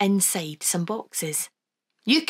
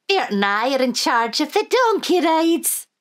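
put-on high, lilting old Scottish granny; Wee Granny voice; 8 semitones higher, 4 dB louder than usual. Granny to the children, high and fond.

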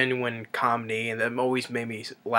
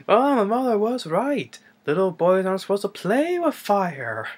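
strangled regret; 9 semitones higher than usual. Retold or imitated. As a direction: imitate a pained guard lamenting in a high voice. Choked guard's comic regret, retold.

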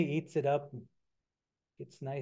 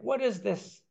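surprised; 8 semitones higher, 3 dB louder than usual. Acted. Trickster waking up confused.

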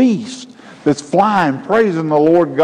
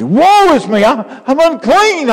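much higher, louder desperate cry; anguished confession; 12 semitones higher, 5 dB louder than usual. Acted. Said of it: "He felt filthy".

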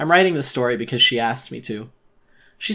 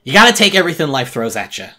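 loud stern version; 4 semitones higher, 9 dB louder than usual. Acted. Lecture then remembered echo.

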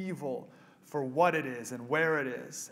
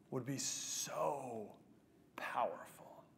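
slow, softer whisper for weight; hushed emphasis; 3 semitones lower, 14 dB quieter, much slower than usual. Real, not acted. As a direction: drop to a slow breathy whisper.

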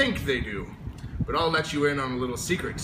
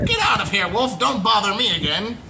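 higher, louder angry pig shout; rude shout; 7 semitones higher, 5 dB louder than usual. A narrator's reading. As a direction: snap at the wolf to go away. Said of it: "what that rude little porker answered".

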